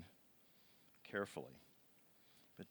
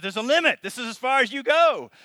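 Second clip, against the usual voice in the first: high, loud firm decree; commanding God voice; 14 semitones higher, 19 dB louder than usual. Acted. Preacher voicing God commanding the sea. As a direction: draw the line with authority.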